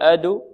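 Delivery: أد ending in "u", "adu"; wrong damma tilt. Shown as a demonstration. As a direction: add an "u" after the d of أد. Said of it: "لا نقول".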